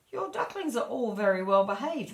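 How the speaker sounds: put-on old duck judging the brood; snooty old duck. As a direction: praise them with a sniff. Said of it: "one of the old ducks said".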